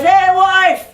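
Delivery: high, loud holler; calling out. Acted. Jack calling to his wife, loud high holler. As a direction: holler for someone in the house. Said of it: "he calls to his wife".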